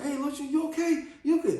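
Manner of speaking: high-pitched, hurried check on a little brother; concerned kids calling out. Retold or imitated. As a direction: call out to see if he's alright. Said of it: "they all said".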